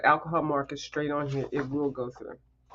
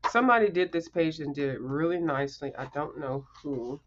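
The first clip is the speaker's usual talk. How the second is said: ordinary talk, nothing marked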